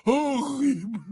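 high, falling, sleepy moan; tipsy, mournful wail; drunken groan. A narrator's reading. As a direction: moan it as you doze off. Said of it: "grogna Hagrid".

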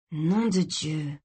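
slow curse under the breath; muted, drawn-out swearing; hissed through teeth. A narrator's reading. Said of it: "siffla Gray entre ses dents".

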